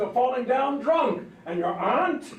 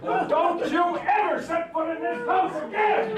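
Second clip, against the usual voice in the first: higher, slow shouted order; higher, slow furious order; angry shout; 7 semitones higher than usual. Acted. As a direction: throw him out of the house. Father throws neighbour out.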